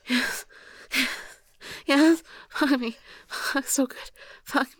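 high, loud breathless cries; gasping, stammered. Acted. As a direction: gasp it out in broken bursts. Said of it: "I'm gasping out a stammered".

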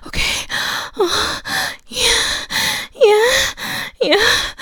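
high, loud, breathless moans; loud moaning. Acted. Acted sex scene, climbing.

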